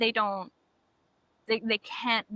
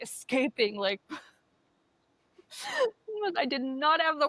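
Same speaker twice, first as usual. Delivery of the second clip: laughing through the words; breaking into giggles mid-sentence; woman cracking up recalling the cockroach scare; 4 semitones higher than usual. Real, not acted. Laughing while retelling the scare.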